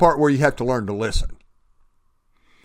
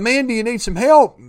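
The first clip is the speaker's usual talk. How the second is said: higher-pitched call, mildly raised; mock yell down; 8 semitones higher, 4 dB louder than usual. Helicopter guy offers help to man on roof, mock yell. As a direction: call down to someone below. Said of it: "guy yells down".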